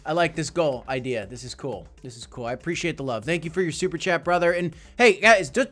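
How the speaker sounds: ordinary talk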